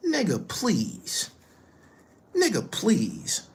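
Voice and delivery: slow, drawn-out scoff; slow contemptuous brush-off; dismissive. Real, not acted. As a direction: wave him off, dragging the words. Dismissing the rival's claim.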